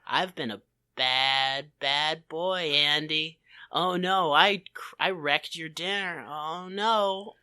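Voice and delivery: lightly voiced coy, seductive apology, soft and low. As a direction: purr it like a guilty flirt. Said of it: "all of his faces are like".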